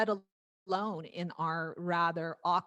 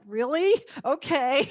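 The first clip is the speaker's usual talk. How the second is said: retold reply, higher and louder; pitched up in happy disbelief; delighted surprise; 7 semitones higher, 6 dB louder than usual. Singer given full permission, delighted surprise. Retelling her amazed reply.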